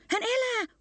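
very high, piping bird shout; bird's cry. A narrator's reading. Bird in the treetop spreads the news, very high cry. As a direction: call it out far up high, like a bird. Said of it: "huusi lintu".

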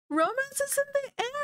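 excited gasp. Acted. Sims flirting, gushing narration.

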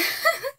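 a wet half-laugh, half-sob that breaks high; crying laugh. Real, not acted. Vlogger to her brother, laughing through tears. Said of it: "I'm, like, crying".

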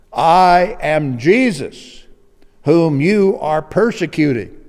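loud, slow, commanding proclamation; Jesus from heaven. Acted. Jesus answering Saul from heaven, loud and commanding. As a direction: declare it with slow divine authority. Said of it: "Listen to this answer".

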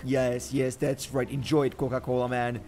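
normal talking voice

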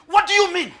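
high, loud demanding what do you mean; hammed-up suspicious shout; mock shouting question. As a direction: hurl the question back. Woman questioning again.

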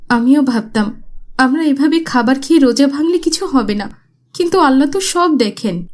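put-on remorseful girl. Girl regrets sin.

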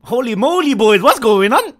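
very loud, high, excited shouting; hyped-up reaction. Real, not acted. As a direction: holler it high, thrilled and shocked. Excited reaction to bathing scene.

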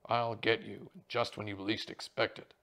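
loud, lightly thickened stage threat; Sir Bonadier's thick voice. A narrator's reading. Inspector mimicking a stage villain for his friend, thick voice. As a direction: deliver the threat as the actor would. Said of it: "uncannily just rendering of Sir Bonadier's thick voice".

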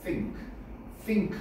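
think의 th를 혀 내밀었다 당기며; think, '씽'과 '띵'의 가운데 소리로; 번데기 th 발음. Shown as a demonstration. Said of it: "혓바닥을 내밀었다가 땡기면서". (think의 첫소리를 혀끝 th로)

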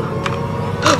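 sharp intake of breath, breathy and quiet; frightened gasp. Real, not acted. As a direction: caught off guard by a scare. Jump scare in horror game.

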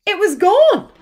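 high, loud gasp of dismay; dramatic reveal. A narrator's reading. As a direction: land it as a shock. Suspense reveal after long pause.

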